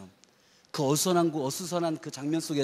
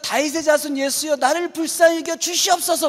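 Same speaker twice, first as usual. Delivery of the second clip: beggar's cry; 11 semitones higher, 6 dB louder than usual. Retold or imitated. Blind beggar crying out.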